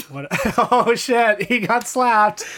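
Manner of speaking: cracking up mid-sentence; laughing through the words; stifled giggles. Real, not acted. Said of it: "Laughing at my own Ernest impression".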